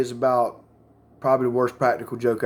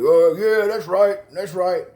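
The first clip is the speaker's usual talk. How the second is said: mock strutting braggart; cocky swagger; 3 semitones higher, 3 dB louder, slower than usual. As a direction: crow like a man showing off. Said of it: "he's a fucking big dick Willie".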